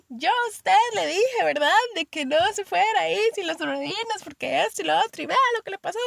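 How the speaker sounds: high-pitched telling-off, imitated; scolding mother. Mother telling off her fallen child, mock scolding. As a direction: scold like an exasperated mum. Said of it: "se viene la regañada".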